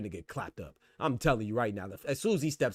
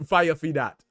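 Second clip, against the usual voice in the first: louder, laughing through the words; 5 semitones higher, 7 dB louder, slower than usual. Real, not acted. To the co-host about Styles, a louder laughing call. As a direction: laugh it out, amused.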